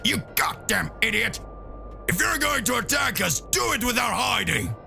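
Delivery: shouted insult; angry Vegeta. Acted. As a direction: bark at the hidden foe. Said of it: "So Vegeta says".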